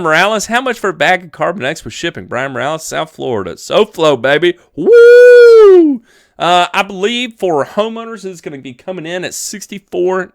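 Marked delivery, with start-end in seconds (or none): 4.8-6.0 a high, loud whoop; loud cheer. Real, not acted. Streamer whoops for a South Florida viewer, high and loud.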